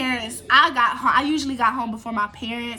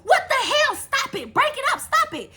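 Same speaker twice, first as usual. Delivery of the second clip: high, hammed-up shrieking driver; imitating the driver's high-pitched yelling; mock bus driver yelling; 11 semitones higher than usual. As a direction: squeal like the panicked bus driver. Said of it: "She said".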